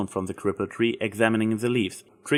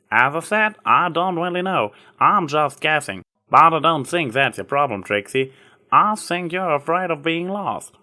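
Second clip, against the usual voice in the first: a hint of a country farm mare, kindly; easygoing farmer, a touch higher; lightly voiced Applejack; 7 semitones higher, 5 dB louder than usual. A narrator's reading. Applejack brushing off Trixie's apology, lightly voiced country mare.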